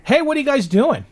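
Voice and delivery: sharp high call across the street. Retold or imitated. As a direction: call out to catch them in the act. Confronting parking enforcers, retold.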